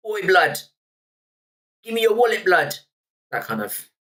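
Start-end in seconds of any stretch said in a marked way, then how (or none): 0.1-2.8 loud, high London roadman voice. Retold or imitated. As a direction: bark it like a street roadman. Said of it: "that's my roadman impression".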